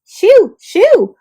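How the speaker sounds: high, loud shooing. Acted. Oak tree shooing pests, loud and high. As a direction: shoo the bugs away loudly.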